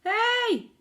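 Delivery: high, loud startled shout. Acted. Cook feeling the mouse up his leg, loud shout. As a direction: jolt out a cry. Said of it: "the cook shouted".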